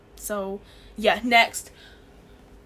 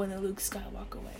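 "in the Luke Skywalker way" whispered, much softer; 2 semitones lower, 12 dB quieter than usual. Shown as a demonstration. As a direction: breathe it out in a whisper.

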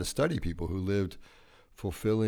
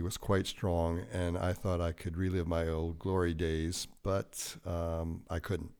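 normal talking voice

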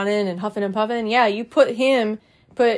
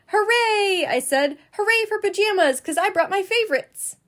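put-on much higher, bouncy little-girl cheer; excited cheer; 9 semitones higher than usual. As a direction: cheer like a kid dancing round the room. Junie cheers for pajamas.

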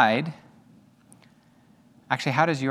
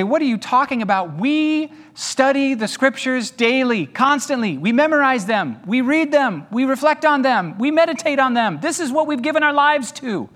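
higher, offended protest of the scholars; indignant Sadducees; 8 semitones higher than usual. Retold or imitated. Sadducees protesting to Jesus, higher and indignant. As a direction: act out their wounded objection. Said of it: "They would say".

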